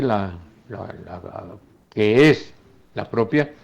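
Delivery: ordinary delivery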